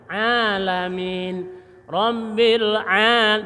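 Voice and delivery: slow "Rabbil al-" with a throaty ain; correct ain. Shown as a demonstration. Teacher showing the proper swallowed ain. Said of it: "harus begitu".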